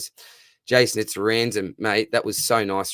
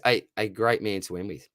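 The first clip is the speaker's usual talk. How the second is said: ordinary talk, nothing marked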